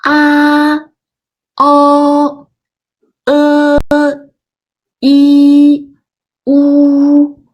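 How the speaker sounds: a, o, e, i, u with the mouth narrowing from a to u; six basic finals. Shown as a demonstration. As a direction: shrink the mouth shape from a down to u. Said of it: "shape of my mouth goes smaller".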